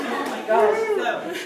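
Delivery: high, slow, drawn-out exasperation; exasperated. Retold or imitated. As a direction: stretch it out, fed up. Retold exasperation.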